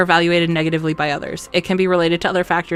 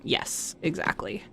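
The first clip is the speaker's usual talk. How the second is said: whispered; 14 dB quieter, slower than usual. Real, not acted. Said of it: "quiet agreement".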